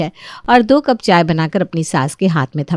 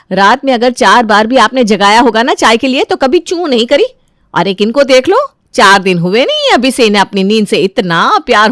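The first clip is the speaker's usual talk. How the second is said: animated, aggrieved complaint with emphatic swings in pitch; indignant elder daughter-in-law; 6 semitones higher, 6 dB louder than usual. Acted. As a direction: protest bitterly, then lay down the decision.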